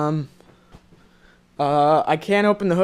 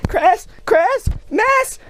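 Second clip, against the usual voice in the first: high, drawn-out shouted name; playful yell; much slower than usual. Real, not acted. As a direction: yell the word out slowly and high. Yelling playfully at friend.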